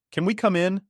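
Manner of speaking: high, sugary mock-polite ask; ex-wife feigning politeness at the door, high and sweet; fake-friendly Catherine. A narrator's reading. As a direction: sound sweet like it's a friendly visit. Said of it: "as if this was a friendly visit".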